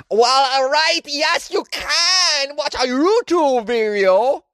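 high, loud, drawn-out goofy voice; silly put-on voice. Host answering listeners' question about videos, silly hammed-up voice.

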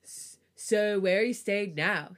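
put-on mildly irritated boyfriend. A narrator's reading. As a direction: sound mildly put out, stretching the question. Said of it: "he asks, sounding irritated".

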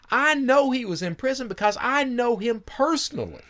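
high put-on voice for the informant; high-pitched retold informant; informant's voice. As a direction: voice the tipster up high.

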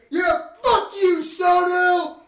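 high, loud, slow jeering fan cry; mock rowdy fan. Mimicking a rowdy New Haven fan jeering at Stonehill. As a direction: jeer like a rowdy rival fan. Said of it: "all of a sudden you're".

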